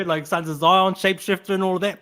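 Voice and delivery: ordinary delivery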